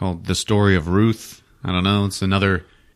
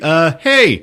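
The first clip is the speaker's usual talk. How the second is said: startled call; 7 semitones higher, 6 dB louder than usual. Retold or imitated. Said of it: "He's like".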